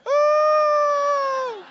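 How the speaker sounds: loud, high, drawn-out wail; a hammed-up cry of pure misery. Hannah refusing her husband's comfort, loud high wail. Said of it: "And she'd go".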